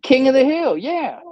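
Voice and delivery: high, loud eager yes; light up as the name lands; excited recognition. Real, not acted. Recalling the cartoon title, excited and loud.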